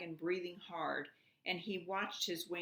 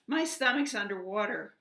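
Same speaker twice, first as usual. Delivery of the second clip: much louder alarmed cry; 4 semitones higher, 9 dB louder than usual. A narrator's reading. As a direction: wake up in alarm and shout. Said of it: "cried the dragon".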